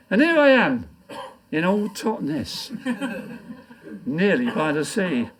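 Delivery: poem recitation. Acted. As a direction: declaim it to the pub crowd. Recites his comic poem.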